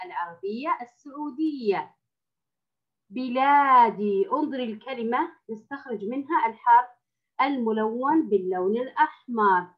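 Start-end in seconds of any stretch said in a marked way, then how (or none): 3.1-4.3 drawn-out model word بلادي; slow model reading. Shown as a demonstration. Teacher models the word for Ola, slow. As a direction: read بلادي slowly, syllable by syllable. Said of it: "تعرفنا في الحصة الماضية على بلادي".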